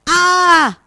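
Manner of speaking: sharp high yelp of pain from a scraped knee; boy's piercing ow; pained cry. A narrator's reading. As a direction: cry out loud as the boy falls. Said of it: "schlägt sich das Knie auf und schreit".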